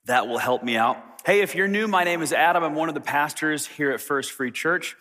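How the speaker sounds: ordinary delivery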